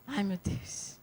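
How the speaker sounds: very soft, resigned murmur of dread; quiet dread. Retold or imitated. Woman bracing for bad news, quiet dread. Recalls dreading the doctor's call.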